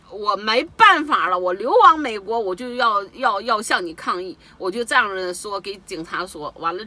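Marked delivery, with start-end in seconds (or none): 0.1-4.2 loud indignant. Real, not acted. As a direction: cry out in helpless anger. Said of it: "我当时就气怒了". (无奈又愤怒地大声喊)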